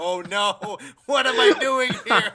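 mock panic rising high into a laugh; half-laughing, pretend horror at the table; laughing through mock alarm; laughing dismay. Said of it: "I was just like".

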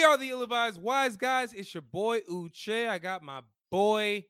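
radio host shout-out, high and bright; hype intro. Acted. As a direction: pump up the intro like a DJ.

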